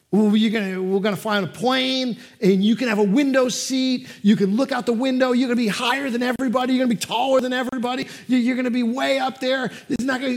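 put-on high, loud, fast sales pitch to a kid; dad promising a window seat, high and eager; eager coaxing. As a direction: rattle off the perks to win him over. Dad coaxing his son.

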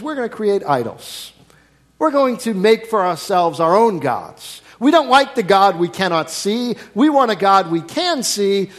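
a hint of stubborn rebels demanding a visible god; mocking Israelites. Preacher voicing Israelites who demand idols, mildly defiant.

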